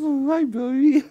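squealing doggy-talk greeting; pet talk voice. Acted. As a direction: gush at the dog like it's a baby. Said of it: "This whole video is gonna be me talking like".

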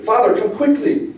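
high, loud call for help; urgent plea. A narrator's reading. Girl calling father to her tortured mother, urgent plea. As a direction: call out urgently, pitch jumping up.

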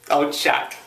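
usual speaking voice

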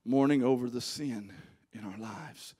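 Mourning over the sin, low and soft, lives fading away; hushed solemn echo; quiet repeat. Shown as a demonstration. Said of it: "repeated more softly".

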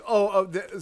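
ordinary talk, nothing marked

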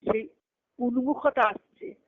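ordinary talk, nothing marked

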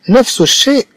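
نفس الشيء lifted high; emphatic. Real, not acted. Teacher stressing to pupils, high and emphatic. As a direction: underline it for the class.

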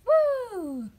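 high loud whoop of excitement; cheer. Real, not acted. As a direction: cheer it out for the bloopers. Cheering bloopers.

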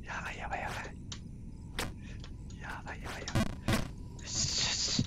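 tense whisper under breath. Real, not acted. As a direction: whisper it in suspense. Tense moment in the game.

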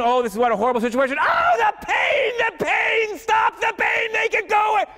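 hammed-up high-pitched wail of pain; mock agony. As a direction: scream it in falsetto as a pretend torture victim. Acts himself being tortured, screaming.

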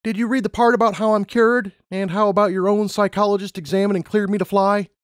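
high-pitched pleading applicant; Lumina, protesting. A narrator's reading. Lumina challenging the interviewer's rejection, high protesting voice. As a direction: argue back, pitch held high throughout.

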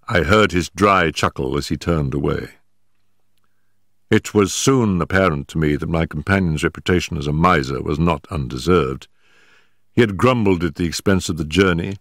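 ordinary talk, nothing marked

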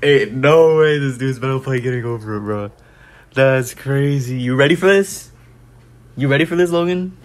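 loud, high hype voice; excited shouting. Real, not acted. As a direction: hype it up loudly.

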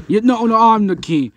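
high, loud mock insistence; insistent rival monster. Monster claiming the crown from a rival, loudly insisting. Two monsters argue who is king.

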